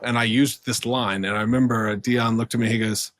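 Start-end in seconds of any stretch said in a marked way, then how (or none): none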